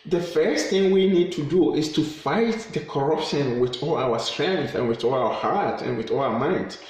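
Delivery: usual speaking voice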